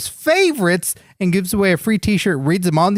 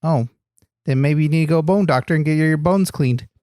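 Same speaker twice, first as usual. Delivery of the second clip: ordinary talk, nothing marked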